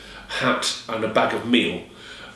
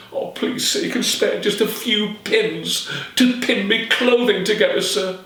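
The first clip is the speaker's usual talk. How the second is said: put-on begging girl; 8 semitones higher than usual. Alison begging again.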